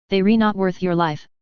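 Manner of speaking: ordinary delivery, nothing marked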